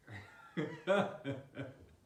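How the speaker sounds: real burst of laughter; helpless giggling laugh. Real, not acted. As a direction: crack up at your own story. Cracking up at his own story.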